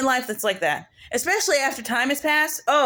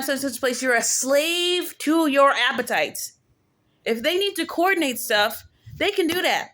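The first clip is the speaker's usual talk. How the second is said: ordinary talk, nothing marked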